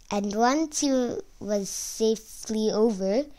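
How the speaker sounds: ordinary delivery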